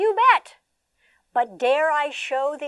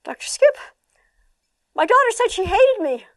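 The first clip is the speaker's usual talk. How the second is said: higher, louder, distressed voice; mother telling her therapist about her daughter; upset mother; 11 semitones higher, 9 dB louder than usual. Retold or imitated. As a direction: plead like a worried mom. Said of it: "She said".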